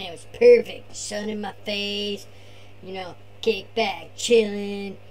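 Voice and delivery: laid-back surfer bro; be the chill beach surfer dude; Tony surfer voice. Acted. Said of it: "turn this over to Tony".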